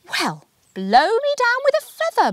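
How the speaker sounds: an excited scientist amazed; astonished Bernadette. A narrator's reading. Bernadette seeing the apple, high and astonished.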